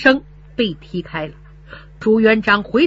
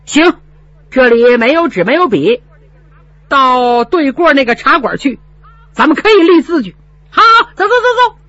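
loud curt agreement; 5 semitones higher, 6 dB louder than usual. Acted. Heated agreement to go.

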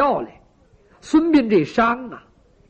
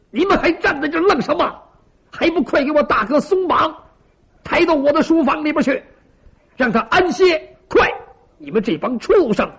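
high-pitched, furious orders to underlings; feigned mad rage; 6 semitones higher, 4 dB louder than usual. A narrator's reading. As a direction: berate the torturers as if beside yourself with anger. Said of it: "好像是气得都有点发疯了".

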